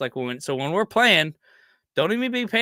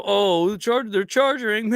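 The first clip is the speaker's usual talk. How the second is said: lightly mocking, raised pitch media voice; 5 semitones higher, slower than usual. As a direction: poke fun at the pundits' hype. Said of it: "they'll say whatever they wanna say".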